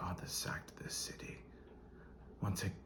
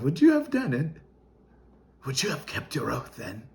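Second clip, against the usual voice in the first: loud, raised-pitch outburst of accusation; angry challenge; 5 semitones higher, 12 dB louder than usual. Acted. Knight challenging his judge over a broken oath, angry and loud. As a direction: demand an answer, voice climbing to a shout.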